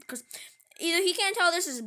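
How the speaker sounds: usual speaking voice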